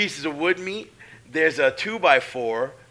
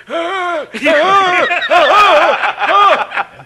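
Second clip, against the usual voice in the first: loud, high mock wails of pain; repeated hammed-up howls of agony; pained cries; 15 dB louder, much slower than usual. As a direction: cry out as if badly hurt. Acting out groans under ring.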